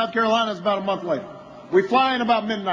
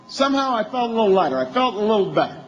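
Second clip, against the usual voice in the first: ordinary delivery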